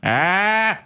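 sustained sidekick's exclamation after a joke. Acted. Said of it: "That's my [name]".

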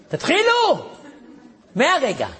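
high commanding cry; sharp order. Retold or imitated. Commander ordering them to start, high and sharp. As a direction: snap the order.

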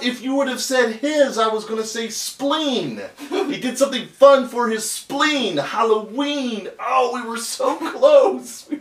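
high, loud and thrilled; giddy with the near rhyme; excited. Real, not acted.

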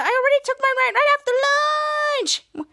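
high, wailed mock protest; acted high whine, stretched at the end; whining protest. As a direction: imitate someone being dragged off to nap. Toddler villain protesting while dragged.

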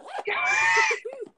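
high burst of shocked laughter. Real, not acted. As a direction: laugh out in disbelief. Laughing at the botched intro.

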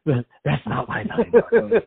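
high, loud, slow, breaking into laughter; cracking up while mimicking; laughing mock quote. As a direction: repeat the line as laughter takes over. Repeats the joke, breaking into laughter.

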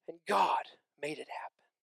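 hushed whisper after the shouting; soft, awed whisper; dramatic whisper. Acted. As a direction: drop to a whisper for weight. Sudden hush after loud preaching.

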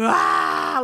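loud imitated wail, falling low; bawling wail. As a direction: let out one loud mock sob. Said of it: "like, I am bawling".

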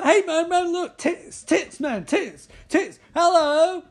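high, hammed-up excited babble; goofy urgent alert to a mate, calling out; freaking out. Guy alerting his mate to women, freaking out excitedly.

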